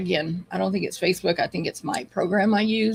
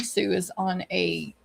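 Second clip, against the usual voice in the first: ordinary talk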